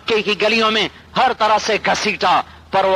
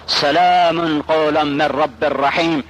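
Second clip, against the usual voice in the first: slow, drawn-out Arabic recitation; Quranic recitation; 4 semitones lower, slower than usual. Acted. Preacher voicing God's greeting to paradise, slow recitation. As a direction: recite the verse slowly, lengthening the vowels.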